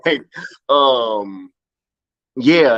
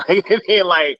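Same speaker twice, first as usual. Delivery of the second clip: normal talking voice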